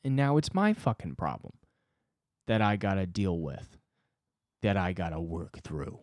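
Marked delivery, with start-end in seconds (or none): none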